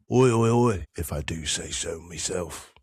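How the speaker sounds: put-on a light London accent, Willie Butcher style; a hint of Willie Butcher's London accent; Willie Butcher impression. Host opening his podcast with a London accent, lightly done. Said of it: "this is my best Willie Butcher impression".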